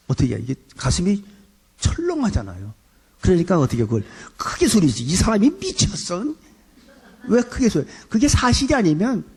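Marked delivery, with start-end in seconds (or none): 5.0-7.9 high, startled mock protest; shocked husband's outburst. Husband caught out by his wife, high startled protest.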